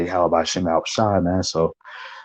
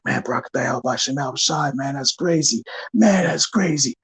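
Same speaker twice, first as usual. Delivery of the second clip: amazed brother; 5 semitones higher than usual. Retold or imitated. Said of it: "Some brother way over there".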